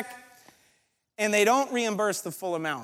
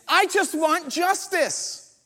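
mock plea for justice; 9 semitones higher, much slower than usual. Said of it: "I get a little angry".

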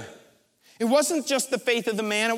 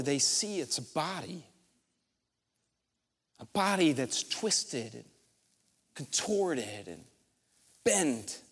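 hushed; 8 semitones lower, 7 dB quieter than usual. A narrator's reading. Preacher to congregation, describing the twisted body, hushed. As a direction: drop low and soft, slowing on contorted. Body lowered, grim reveal.

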